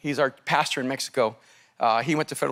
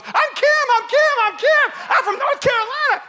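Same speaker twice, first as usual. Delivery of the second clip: put-on big Southern accent; 5 dB louder than usual. Wife introducing herself to show staff, thick Southern accent. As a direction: ham up a Southern belle accent. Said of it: "She played up that accent so big".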